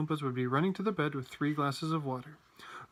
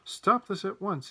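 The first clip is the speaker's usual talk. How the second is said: slow, higher stern command; stern order; 6 semitones higher, 4 dB louder, slower than usual. A narrator's reading. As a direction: cut in with measured authority. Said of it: "cutting in sternly through the rabble".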